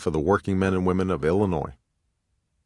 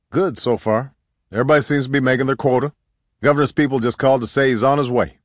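higher, louder hearty report; upbeat deacon; 6 semitones higher, 4 dB louder than usual. A narrator's reading. Deacon reporting turnout to the organizer, upbeat and hearty. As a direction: pitch it up like an eager deacon.

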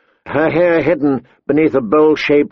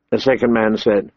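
usual speaking voice

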